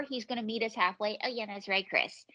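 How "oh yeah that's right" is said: normal talking voice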